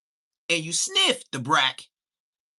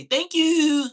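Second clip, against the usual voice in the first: high thank you; 12 semitones higher, 7 dB louder than usual. Shown as a demonstration. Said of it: "You see why I say".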